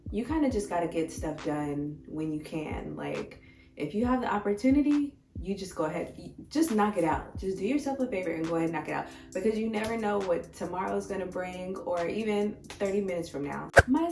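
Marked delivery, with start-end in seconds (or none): none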